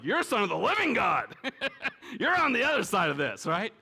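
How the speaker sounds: a lightly voiced eager disciple, laughing; Peter's excited reply. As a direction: play Peter blurting it out with a chuckle.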